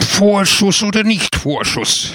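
high, shrill indignant yell; piercing, high-pitched protest; shrill cry. A narrator's reading. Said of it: "schrie er mit schriller Stimme".

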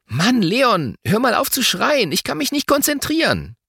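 put-on fed-up child telling off a friend; exasperated. A narrator's reading. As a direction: complain with pitch climbing steadily. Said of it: "Finn mischt sich ein".

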